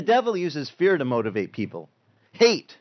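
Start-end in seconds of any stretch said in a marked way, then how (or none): 2.3-2.8 sudden loud word. Acted. Preacher naming the devil's motivator, loud. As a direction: punch it out loud and high.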